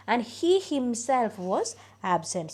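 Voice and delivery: "himself" drawn out slowly and weighted; stress on himself. Shown as a demonstration. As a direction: dwell on "himself" to show it was him. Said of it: "ആ himself-ിനെ നമ്മൾ അത്രത്തോളം stress ചെയ്താണ് പറയുക". (himself എന്നതിൽ ശക്തമായ ഊന്നൽ)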